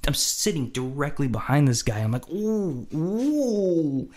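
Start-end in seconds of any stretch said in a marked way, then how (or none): 2.3-4.1 high, drawn-out gleeful ooh; teasing ooh. Acted. Sitting behind the mad guy, teasing reaction. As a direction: ooh like a kid watching trouble start.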